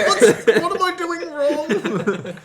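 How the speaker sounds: high, loud and slow, laughing; plead, lost and amused; mock helpless taster, high and slowed; bewildered whine. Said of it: "I'm like".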